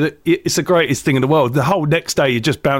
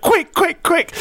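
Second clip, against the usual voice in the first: high, rushed quick quick; urgent hurry; 12 semitones higher, slower than usual. Retold or imitated. Comedian urging his friend to the bar, high urgent calls. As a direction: hurry him over before they leave. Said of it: "I went".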